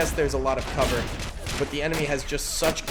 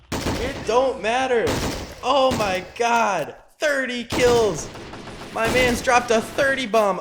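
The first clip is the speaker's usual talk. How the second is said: hyped; 7 semitones higher, 3 dB louder, slower than usual. Real, not acted. Hyping the sniper.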